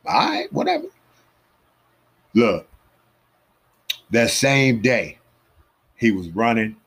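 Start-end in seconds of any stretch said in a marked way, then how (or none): 0.1-0.9 high, whining all right whatever; exaggerated, high-pitched whatever; mock whiny dismissal. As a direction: brush him off in a put-upon whine. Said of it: "I'm like".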